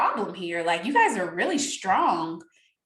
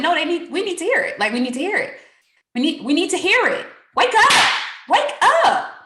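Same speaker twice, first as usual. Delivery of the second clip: loud, higher wake-up call; loud urging; 6 semitones higher, 6 dB louder than usual. Retold or imitated. Urging the team to hear it, loud. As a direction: shake them awake. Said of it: "I said".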